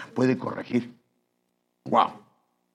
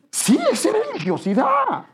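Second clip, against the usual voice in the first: laughing. Real, not acted. Preacher reaffirms point after laugh.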